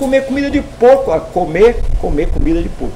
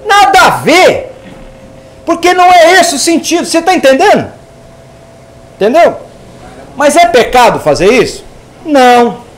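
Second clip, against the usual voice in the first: emphatic; 5 semitones higher, 6 dB louder than usual. Real, not acted. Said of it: "teacher stresses point".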